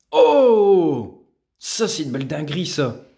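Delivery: sudden loud high gasp of surprise; a real jolt of amazement; surprised. Real, not acted. Amazed at a rare card.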